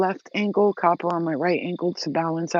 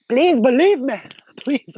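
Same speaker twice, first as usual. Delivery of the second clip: higher, louder urgent plea; begging; 7 semitones higher, 7 dB louder, slower than usual. Acted. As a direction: plead to be believed. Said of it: "I'm begging you".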